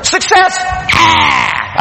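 high exultant cry, ending in "Ah"; triumphant. Acted. Preacher acting out success after failures, triumphant. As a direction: burst out as if finally winning.

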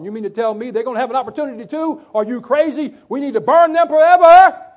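mock outrage climbing to a high, loud yell; wrathful man. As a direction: act out incredulous fury, exploding on "burn them forever". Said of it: "see, man is wrathful".

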